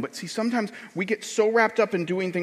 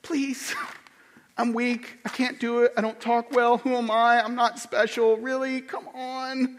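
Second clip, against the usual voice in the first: slightly high, whiny list of excuses; mock pleading; 4 semitones higher than usual. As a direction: plead weakly, dragging out the protest. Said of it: "we're going".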